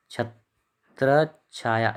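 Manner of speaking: छत्र छाया very slowly, split "chhat-rach ... chhaya"; syllable by syllable. Shown as a demonstration. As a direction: spell out छत्र छाया by ear with a doubled "ch-chh". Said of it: "ए चा और फिर छा या".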